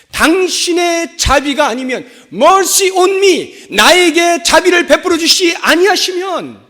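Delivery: loud, high preacher's plea; impassioned cry for mercy. Acted. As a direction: cry out desperately to the Lord. (자비를 구하며 크게 부르짖는 목소리)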